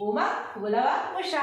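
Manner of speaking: unstretched ఉ opening ఉమ and ఉష; very short vowels. Shown as a demonstration. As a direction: say ఉమ, ఉలవ, ఉష with a brief ఉ. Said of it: "చాలా షార్ట్గా పలికాం".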